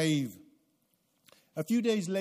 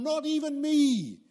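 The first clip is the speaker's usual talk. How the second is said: higher, louder peak on the last words; emphatic confession; 8 semitones higher, 6 dB louder than usual. Acted. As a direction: drive home that nobody is excepted. Confession climax.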